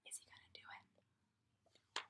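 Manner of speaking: whispered suspense question. Acted. Teacher builds suspense for the children, whispered. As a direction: lean in and whisper to the kids. Suspense aside during story.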